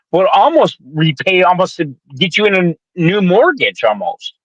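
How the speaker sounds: ordinary delivery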